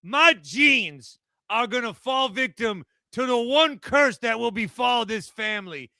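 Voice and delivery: put-on New York accent. Mock New York Jets caller.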